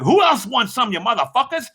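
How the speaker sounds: high-pitched retold battle cry; aggressive challenge. Driver jumps out to face the crowd, challenging them. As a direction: call out the whole crowd.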